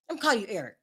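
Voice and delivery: normal talking voice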